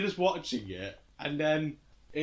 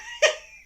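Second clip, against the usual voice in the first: high squealing laugh; real helpless laughter; high cackle; 6 dB louder than usual. Real, not acted. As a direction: burst into a shrill laugh. Bursts out laughing.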